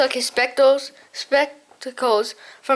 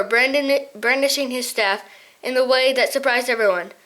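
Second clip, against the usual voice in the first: ordinary delivery, nothing marked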